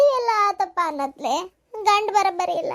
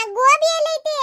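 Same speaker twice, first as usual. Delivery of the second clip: calling out; 9 semitones higher, 5 dB louder than usual. Acted. Calling out to mother-in-law.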